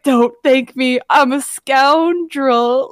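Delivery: playful imitation of a self-declared villain, drawn out at the end; mock scoundrel. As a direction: protest theatrically, stretching out "scoundrel". Acting out the scoundrel's reply.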